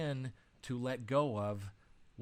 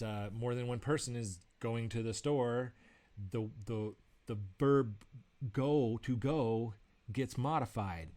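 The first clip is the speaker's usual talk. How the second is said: ordinary delivery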